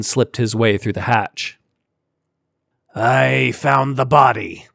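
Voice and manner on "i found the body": slow growl from a big alien. Acted. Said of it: "Sar growled".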